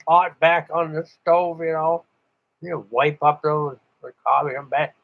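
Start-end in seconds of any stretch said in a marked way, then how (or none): none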